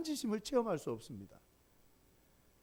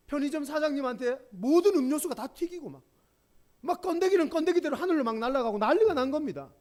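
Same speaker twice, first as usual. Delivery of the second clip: higher and louder, building chaos; lively storytelling, raised voice; excited retelling; 7 semitones higher, 6 dB louder than usual. Real, not acted. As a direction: paint the mess with gusto. Preacher tells chaotic spill.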